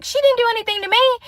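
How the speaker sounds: very high-pitched, incredulous comeback; defensive protest. Retold or imitated. Defending herself to friend, high defensive protest.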